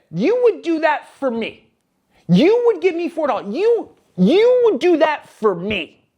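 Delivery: amazed, grateful disbelief at a stranger's offer; touched disbelief. Retold or imitated. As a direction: marvel at her kindness, drawing it out. Moved by the stranger's kindness.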